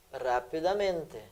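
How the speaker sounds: slow, soft enunciation of each syllable; measured, as if writing it out; drawn-out syllables. Shown as a demonstration. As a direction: stretch RAPIDAMENTE out slowly and quietly. Teacher spells out word by syllables.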